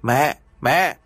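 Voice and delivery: a son calling from the gate; two short calls for mother. A narrator's reading. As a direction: call up to the house. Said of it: "dưới cổng có tiếng người gọi".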